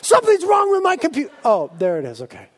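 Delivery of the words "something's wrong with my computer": very high, loud, fast panicked shout; mock freak-out. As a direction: yell for help in exaggerated panic. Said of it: "I'm freaking out".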